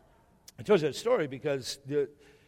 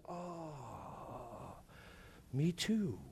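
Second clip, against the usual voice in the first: put-on much softer, slow broken-English old man voice; imitating old man; 16 dB quieter, much slower than usual. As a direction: voice a frail elderly man, gently and slowly. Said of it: "He goes".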